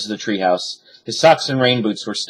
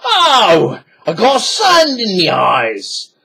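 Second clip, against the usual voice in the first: much higher, loud whiny pirate; whined; 9 semitones higher, 4 dB louder, much slower than usual. A narrator's reading. Pirate complains to his captain, high whine. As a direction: whine like a big baby. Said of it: "Pinky whined".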